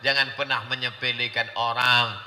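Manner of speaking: normal talking voice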